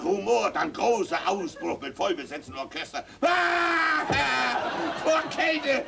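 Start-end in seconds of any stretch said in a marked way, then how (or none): 3.2-5.8 high, loud roaring jeer; loud high outburst mocking from the cold; mocking shout. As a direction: shout the joke down. Uproar, mocking the explanation.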